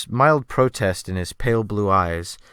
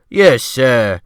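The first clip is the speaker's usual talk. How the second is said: loud, eager servile cook voice; bright, loud and quick to please; cheerful sea cook; 2 semitones higher, 9 dB louder than usual. Acted. The cook answering the gentleman eagerly, loud and cheerful. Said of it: "he answered with a cheerful alacrity".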